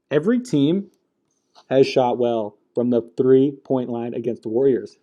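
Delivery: ordinary talk, nothing marked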